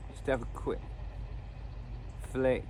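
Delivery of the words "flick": ordinary talk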